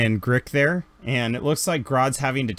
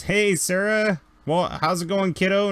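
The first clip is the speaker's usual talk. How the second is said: bright higher friendly greeting; cheerful uncle; 7 semitones higher than usual. Acted. Kyle greeting Sarah like a fond uncle, higher and cheerful. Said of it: "and he goes".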